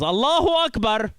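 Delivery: high shouted razor battle cry; mock yell. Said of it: "the third one yells".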